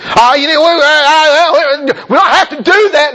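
high, loud stammering objection; mock whining protest. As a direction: splutter and drag out the excuse. Acts out a protesting church member.

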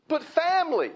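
high, loud mock objection; imitated outburst of protest. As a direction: voice a pleading objection at high pitch. Acted objection, answered "but God.

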